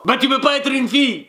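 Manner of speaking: light put-on Japanese accent; rough imitation Japanese accent; attempted Japanese accent. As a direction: challenge in a mock Japanese accent. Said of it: "j'essaie de faire l'accent japonais".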